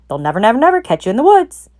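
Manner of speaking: rising excited shout. A narrator's reading. Goose shouting encouragement to Wilbur. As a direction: cheer him on loudly. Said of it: "she shouted to Wilbur".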